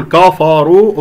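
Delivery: كفروا with k and f thick like "kaw-faw"; heavy kaf. Shown as a demonstration. Teacher shows an error, كفروا with heavy kaf and fa. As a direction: darken the ك and ف of كفروا. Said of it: "أفخم الكاف والفاء".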